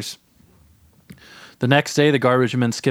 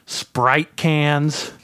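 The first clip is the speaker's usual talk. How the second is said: usual speaking voice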